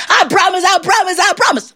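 high, squealed promises; frantic pleading. Acted. Trapped young devil promises John anything, frantic.

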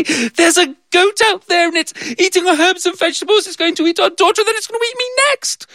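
very high frantic voice; breathless rush of panic; panicked wife. Acted. Wife running to her husband about the goat, high and frantic. Repeats slower.

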